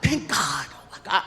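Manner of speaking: ordinary talk, nothing marked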